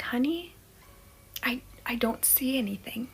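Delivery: put-on near-whisper to a child; very soft, hesitant reassurance with a small stumble; hushed. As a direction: whisper it carefully, as if afraid to look. Mother scared, answering son.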